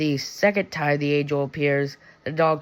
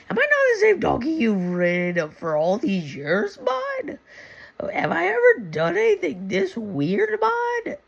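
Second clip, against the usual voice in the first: squeaky, much higher cartoon donkey voice; talking donkey; 12 semitones higher than usual. The donkey reasoning with Balaam, much higher squeaky cartoon voice. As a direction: pitch the donkey way up and plead like a buddy. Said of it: "the donkey is like".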